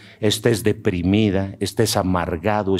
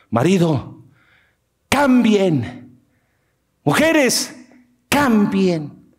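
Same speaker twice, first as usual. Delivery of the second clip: higher calling voice; 9 semitones higher, much slower than usual. Retold or imitated. God calls husbands and wives to change, higher call. Preacher voicing God's call.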